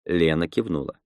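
ordinary delivery

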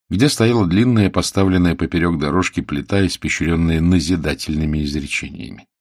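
ordinary delivery, nothing marked

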